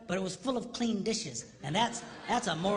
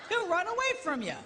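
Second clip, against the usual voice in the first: ordinary talk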